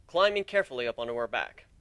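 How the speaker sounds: plain reading voice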